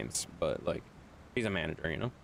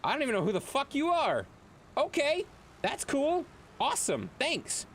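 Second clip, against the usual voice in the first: confused mock reaction; 6 semitones higher than usual. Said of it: "and I'm like".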